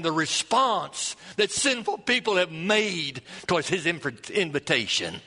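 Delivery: preaching. Acted. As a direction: urge the congregation on. Closing sermon exhortation.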